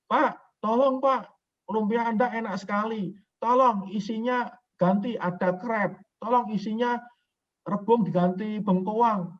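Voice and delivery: high, pleading mock-customer voice. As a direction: beg him like a customer asking for changes. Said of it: "Contoh lumpia".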